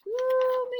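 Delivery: high, drawn-out "ooh"; mock-scared ooh. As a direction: coo a long high note of pretend alarm. Reacts to the shark's threat.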